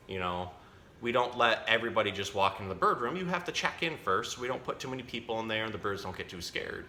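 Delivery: ordinary talk, nothing marked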